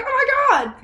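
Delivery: high-pitched excited exclamation; retold elation. Actor thinking about getting the part, high excited exclamation. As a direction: squeal it as if reliving the big news. Reliving the moment of being cast.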